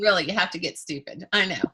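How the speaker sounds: normal talking voice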